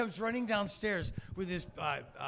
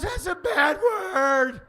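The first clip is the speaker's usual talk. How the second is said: very high, loud, slow tearful whine; high, drawn-out crying kid's voice; sobbing child; 14 semitones higher, 11 dB louder, much slower than usual. Retold or imitated. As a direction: scold through tears like a hurt little boy. Upset kid answers back.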